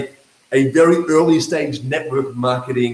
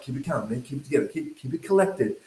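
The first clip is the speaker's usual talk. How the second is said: very soft, hushed self-talk; hushed self-talk to stay calm; calming himself; 9 dB quieter, faster than usual. Retold or imitated. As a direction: talk yourself down. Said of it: "so I was like".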